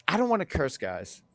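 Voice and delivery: normal talking voice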